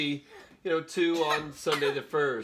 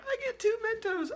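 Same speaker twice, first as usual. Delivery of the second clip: put-on kid voice. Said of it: "Dominic was like".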